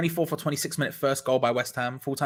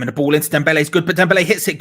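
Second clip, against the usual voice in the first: louder, fast rush as the shot goes in; excited burst; 9 dB louder, faster than usual. Real, not acted. Fan commentator calling a shot at goal, excited. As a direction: call the chance at speed, voice jumping up.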